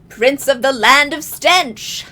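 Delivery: slow, loud villain taunt; gloating villain. Retold or imitated. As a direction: savor the cruel joke. Jareth taunting Hoggle.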